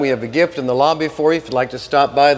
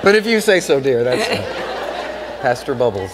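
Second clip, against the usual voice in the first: laughing; 5 semitones lower, slower than usual. Real, not acted. Teasing nickname, laughing together.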